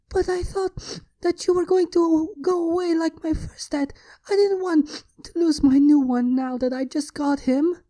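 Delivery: put-on high child's voice, tearful and pleading; a small child sobbing out her fear; crying little girl. A narrator's reading. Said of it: "clutching his chest tightly and crying".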